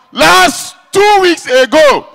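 slow, loud shouted opener; comic shout. Real, not acted. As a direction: call it out slowly to the crowd. Shouted opener of new story.

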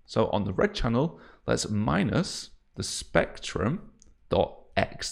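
ordinary delivery

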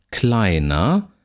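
"kleiner" ending in an open a-like vowel, no consonant R; vocalised R. Shown as a demonstration. Lecturer shows students R-vocalisation, vowel ending. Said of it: "Hier kein R im Sinne eines Konsonanten".

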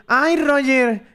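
high-pitched mock bewilderment; mock clueless commenters. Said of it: "luego mucha gente".

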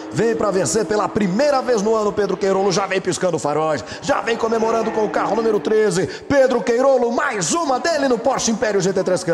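rising, shouting the winner home; excited finish call. Real, not acted. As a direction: call the victory at full voice. Race winner crossing line. (narração de vitória, empolgada e gritada)

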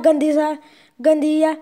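loud playful scold; teasing scold. Real, not acted. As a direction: tease the dog playfully. Teasing the dirty dog.

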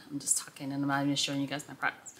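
quick guilty whisper aside. Real, not acted. To viewers, realizing she forgot the products, whispered. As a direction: whisper it fast, caught rambling. Aside caught talking instead.

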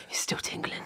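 whisper. Retold or imitated. Retold line in a whisper.

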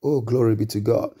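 ordinary talk, nothing marked

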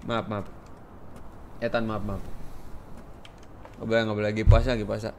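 low, soft mumbled sorry; hushed, chastened and low; sheepish apology. Real, not acted. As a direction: back down quietly after an outburst. Sheepish apology after outburst.

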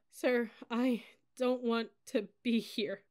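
high, slow, tearful confession; broken sobbing plea; between sobs. A narrator's reading. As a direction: get it out through crying. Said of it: "I said between sobs".

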